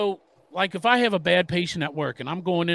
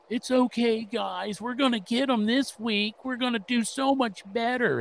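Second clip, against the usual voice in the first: higher, mild mock pep talk; Andy Dalton pep talk; 5 semitones higher than usual. A hint of mock quarterback cheering up teammates. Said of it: "I just heard an Andy Dalton pep, pep talk".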